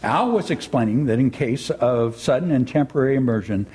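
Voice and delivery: normal talking voice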